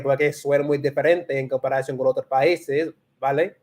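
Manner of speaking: best Castilian accent, lisped c's; Castilian Spanish accent. Shown as a demonstration. Learner showing off for live viewers, Castilian accent. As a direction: put on a Spain Spanish accent. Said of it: "my best, uh, Castellano accent".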